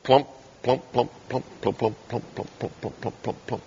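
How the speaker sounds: low imitated plump sounds fading to a breath; stones dropping one by one. Preacher mimics accusers letting their stones fall, fading. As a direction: mimic stones dropping, dying away to nothing. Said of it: "you begin to hear".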